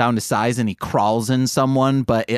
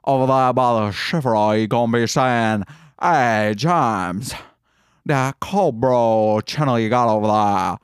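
put-on lower, lightly done Southern accent; Southern accent, voicing a fan asking James for a video; Southern drawl; 3 semitones lower than usual. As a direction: slip into a lazy Southern sleuth voice. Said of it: "dude on Knives Out talks".

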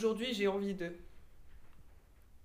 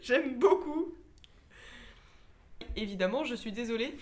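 ordinary talk

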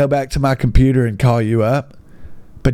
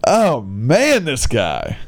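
ordinary talk

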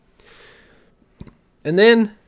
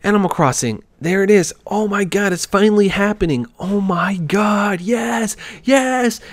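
high, building excited voice; hyped-up fans; 7 semitones higher than usual. Retold or imitated. Imitating Animal Crossing fans reacting to the reveal, hyped up. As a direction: imitate fans rising higher with mounting hype. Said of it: "everybody's getting hyped up".

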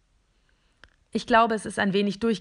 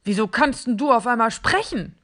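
louder, startled question; astonished; 3 semitones higher, 7 dB louder than usual. Acted. Plastic figure amazed the robot can talk, astonished. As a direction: jump back in amazement. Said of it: "fragt es erstaunt".

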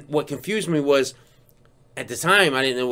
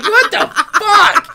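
laughing disbelief; 7 dB louder, slower than usual. Retold or imitated. Disbelief after hang-up.

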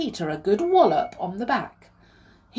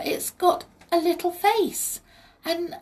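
high, wondering excitement, drawn out at the end; excited; 11 semitones higher, 5 dB louder than usual. A narrator's reading. Molly describes the fairy to Peter, excited. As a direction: build the list in high-pitched excitement. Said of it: "said Molly in excitement".

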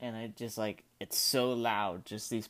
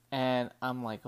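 usual speaking voice

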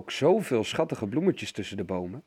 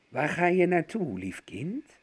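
aardige wolvenstem; 5 semitones higher than usual. A narrator's reading. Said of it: "met een wel heel aardige stem voor een wolf".

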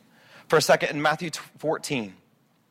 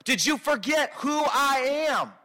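pleading Jesus; 5 semitones higher than usual. Retold or imitated. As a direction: remind him who you are. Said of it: "Jesus is like".

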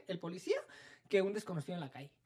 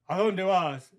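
gruff cop demand; 8 dB louder, much slower than usual. Retold or imitated. Cop challenging her at night.

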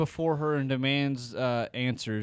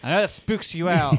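louder, higher teasing jab; 6 semitones higher, 8 dB louder than usual. Real, not acted. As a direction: poke fun playfully. Teasing friend about fire.

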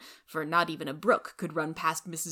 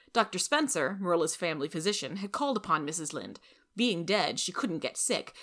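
usual speaking voice

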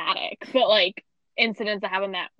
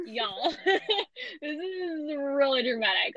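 laughing slow; much slower than usual. Retold or imitated. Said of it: "And I was like".

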